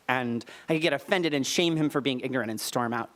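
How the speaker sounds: ordinary delivery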